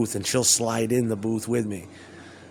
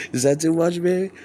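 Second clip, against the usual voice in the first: mock tender; 5 semitones higher, 3 dB louder than usual. His reply in the scene.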